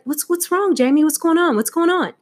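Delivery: high, urgent questioning as a concerned mother; worried mom. Retold or imitated. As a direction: imitate an alarmed mom checking on her child. Mom finds her daughter crying.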